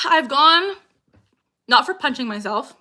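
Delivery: ordinary talk, nothing marked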